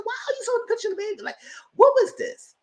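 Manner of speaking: very high, fast, squeaky disbelief; incredulous squeal. Retold or imitated. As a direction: rattle it off in shocked squeal. Retelling her reaction to baby photo.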